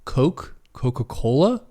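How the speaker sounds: incredulous questions with rising ends; baffled disbelief. Retold or imitated. Brother baffled at being asked what kind of Coke, incredulous. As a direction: act out a baffled customer's reaction. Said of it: "the look on his face was like".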